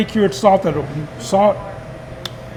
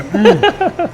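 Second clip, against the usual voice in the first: mouth full. Real, not acted. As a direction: hum through a full mouth. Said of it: "I can't help but say".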